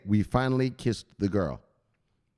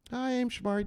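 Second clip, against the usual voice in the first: mock friendly intro; 11 semitones higher, 5 dB quieter than usual. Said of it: "Fancy to see you here".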